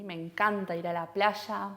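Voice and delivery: ordinary talk, nothing marked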